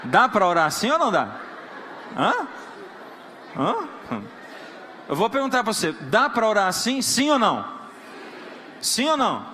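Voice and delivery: loud, laughing challenge to the congregation; preacher's loud laughing challenge; challenging the crowd. Real, not acted.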